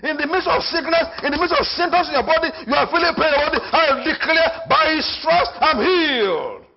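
high, loud declaration; fervent sermon voice; full-force preaching. Acted.

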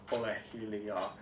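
low, soft "be quiet"; hushed command. Acted. Reciter tells the listener to be quiet, hushed.

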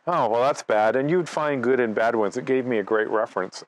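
ordinary talk, nothing marked

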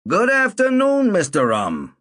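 a lightly voiced old man, almost cheerfully. A narrator's reading. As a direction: Saul greets the narrator, almost cheerful. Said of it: "he said almost cheerfully".

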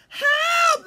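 very high, loud storybook shriek; cry for help. A narrator's reading. Birds crying as the fox leaps, high panicked shout. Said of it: "they all cried".

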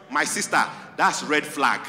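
normal talking voice

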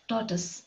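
tortoise as TOR-tus, second syllable weak; correct pronunciation. Shown as a demonstration. Teacher repeating the accepted sound for pupils, tortoise as TOR-tus. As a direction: end "tortoise" on a reduced "-tus". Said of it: "pronounced as tortoise, not tortoise".

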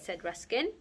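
plain reading voice

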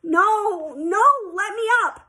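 high, loud, wailing refusal; pleading protest. A narrator's reading. Sheila stopped on the ladder, protesting. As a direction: wail to be let out.

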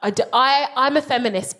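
normal talking voice

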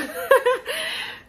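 squealing laugh, high and bright; high burst of laughter. Real, not acted. Laughing at own joke.

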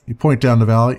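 ordinary talk, nothing marked

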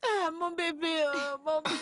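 high, loud tearful wail; sobbing goodbye to her son; crying farewell. Acted. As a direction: cry out to her baby as he leaves. Mother parting from her son.

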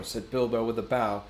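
usual speaking voice

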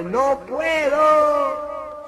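agudo y muy lento; voz de personaje. Acted. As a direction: estirar la queja desde abajo. El de abajo responde. (el de abajo contesta, lento y agudo)

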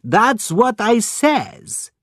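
put-on high, slow, indignant foreign-accented retort; getting angry. A narrator's reading. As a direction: insist indignantly, drawing the words out. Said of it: "getting angry".

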